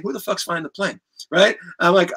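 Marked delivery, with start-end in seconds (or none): 0.0-1.0 mock panicked aside, quieter; nervously muttered question; hushed alarmed question. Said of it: "I'm saying".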